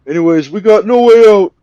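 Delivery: high, loud panicked yelling; shouting kidnapper. Retold or imitated. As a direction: bark the threat like a cornered crook. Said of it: "He's like".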